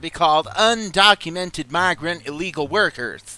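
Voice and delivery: high, sassy drag queen voice; Dahlia voice. Acted.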